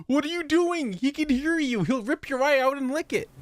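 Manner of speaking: very high-pitched mock panic; frantic warning. Warning her he's right around the corner, high mock panic. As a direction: squeal the warning in alarm.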